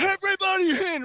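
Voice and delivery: high call to the crowd. Retold or imitated. Soldier calling all the kids in, high call. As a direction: wave everyone in.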